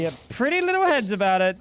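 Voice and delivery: condescending sing-song tease; teasing. Acted. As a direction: patronise them playfully. Teasing put-on voice to chat.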